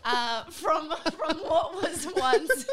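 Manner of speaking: high, loud, helpless laughter; trying to talk while cracking up; laughing through words. Real, not acted. Cracking up at own word.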